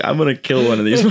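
fast, laughing; fast laughing line while driving too wildly. Retold or imitated. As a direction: rattle it off mid-laugh. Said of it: "I'm just laughing more and more".

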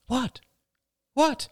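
put-on very high child's voice; excited little boy. A narrator's reading. Son begs to hear father's idea, excited. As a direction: squeak out an eager question, pitch way up. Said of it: "Ben's voice was excited".

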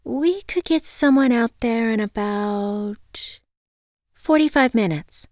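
high, drawn-out receptionist voice; hesitant receptionist. A narrator's reading. Receptionist estimates the wait for a caller, hesitant.

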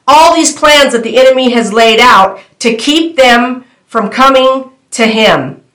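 ordinary talk

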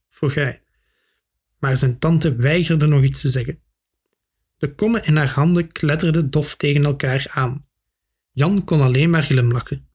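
usual speaking voice

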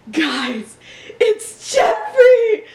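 high, loud, stretched-out yell through laughter; mock panicked shout while laughing. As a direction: shriek it like a kid in a panic. Kid panics spotting deer.

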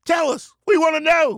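high, pleading crowd cry; eager congregation. Acted. Followers beg the Rev for his pick, high eager cries. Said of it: "Who's gonna win, Rev?".

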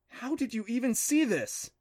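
high-pitched, astonished question; incredulous, pitch well up; amazed question. Acted. Boy amazed at her find.

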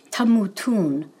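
"tamutun" pronounced as Hebrew, set apart from the English; teacher naming the plural verb "tamutun" in Hebrew; Hebrew pronunciation. Shown as a demonstration. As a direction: give "tamutun" its Hebrew sounds, clearly. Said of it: "you will die".

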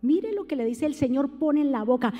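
ordinary talk

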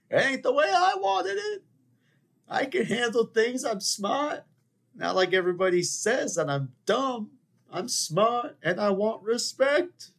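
very high, loud wounded outburst; Godfather Two impression. Passed-over older brother demands respect, high-pitched outraged impression. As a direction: wail the protest in a strained movie impression. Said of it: "Godfather Two, anyone?".

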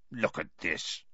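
low, soft menacing growl. Acted. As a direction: growl it under your breath. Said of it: "he growled".

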